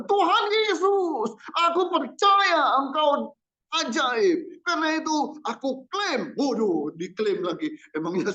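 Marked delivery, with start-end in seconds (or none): 0.0-6.3 much higher, slow, showy prayer voice; high, drawn-out mock faith-claim prayer. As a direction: parody someone claiming a miracle. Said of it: "kita suka bilang".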